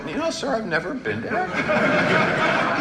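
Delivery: high, slowed, laughing through the words; barely holding back laughter. Real, not acted. Former governor correcting a stranger, laughing through it.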